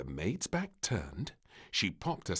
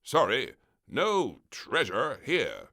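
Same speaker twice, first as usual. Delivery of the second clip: firm admiral; 5 semitones higher, 8 dB louder, slower than usual. Acted. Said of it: "said the admiral".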